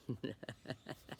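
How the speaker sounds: soft, voiceless chuckle; laugh. Real, not acted. Reader amused by the spaghetti poem, soft chuckle. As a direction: laugh lightly under your breath.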